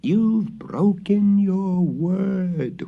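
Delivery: high, very slow eerie ghost voice; ghost's soft accusation. A narrator's reading. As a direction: breathe the accusation at him. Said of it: "whispered the spirit so softly".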